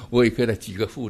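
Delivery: normal talking voice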